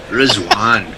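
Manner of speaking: Rizwan with a rolled Scottish r; Glaswegian accent. Shown as a demonstration. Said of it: "I always wanna say it in a Glaswegian accent".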